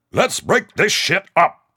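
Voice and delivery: slow, loud, high commanding shout; barked order. Acted. MP sergeant ordering the brawling soldiers apart. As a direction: break up the fight with authority. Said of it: "the M P sergeant ordered".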